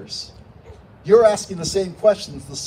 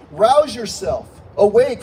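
mildly urgent, raised plea; desperate appeal; 4 semitones higher, 6 dB louder, slower than usual. Acted. Psalmist pleading with a sleeping God, mildly desperate. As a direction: call out louder and higher, as if rousing a sleeper. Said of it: "sounding more and more like a desperate appeal".

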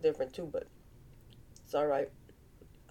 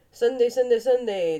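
playful announcing; 6 semitones higher, 6 dB louder than usual. Pretend. Announcing Sunday games playfully.

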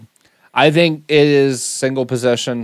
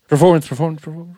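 fake echo; 3 semitones higher, faster than usual. Faking reverb echo on word.

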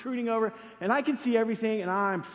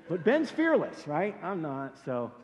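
ordinary delivery

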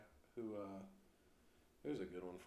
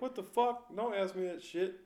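much higher and loud; incredulous outburst; 11 semitones higher, 9 dB louder than usual. Retold or imitated. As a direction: blurt it out, shocked and appalled. Coworker shocked by question.

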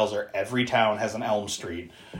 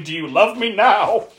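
raised, higher mock scream; retold scream; 7 semitones higher, 4 dB louder than usual. Retelling the girl attacking her father, mock screaming. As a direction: play her screamed demand, voice pitched up.

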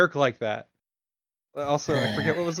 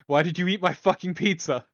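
higher-pitched, raised-voice accusation; indignant demand; 6 semitones higher, 4 dB louder than usual. Acted. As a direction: demand an answer, voice climbing in outrage. Same question repeated, quarrel escalates.

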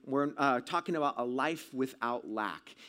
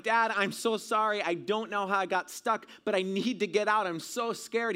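high, raised, pleading voice; shouting to dad; 6 semitones higher, 4 dB louder than usual. Retold or imitated. Scared boy apologising to his dad, shouting to him. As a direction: plead urgently through a barrier, acting it out. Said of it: "I started shouting to my dad".

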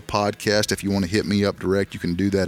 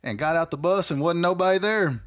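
lightly voiced boy, pitched much higher; a hint of a young basketball player complaining; imitating a kid; 9 semitones higher than usual. Retelling what the little basketball boy said, a lightly voiced, higher kid. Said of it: "he goes".

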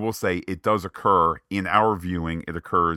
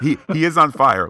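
laughing; 7 semitones higher, 9 dB louder than usual. Real, not acted. Laughing at cohost's joke.

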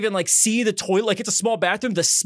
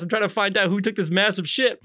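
normal talking voice

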